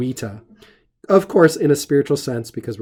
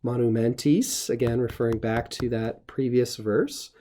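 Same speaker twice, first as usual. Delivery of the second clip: ordinary delivery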